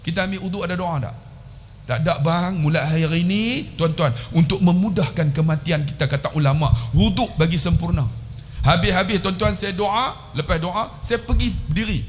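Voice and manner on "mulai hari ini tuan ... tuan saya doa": ordinary talk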